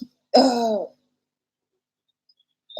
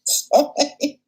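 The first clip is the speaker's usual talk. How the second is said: suppressed laughter; 7 semitones higher than usual. Real, not acted. Laughing at the comments again.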